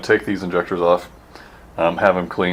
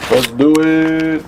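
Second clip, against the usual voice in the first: slow, loud, higher rallying call; drawn-out cheer; 9 semitones higher, 5 dB louder, much slower than usual. Real, not acted. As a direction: stretch it out like a pep cry. Same phrase, drawn-out cheer.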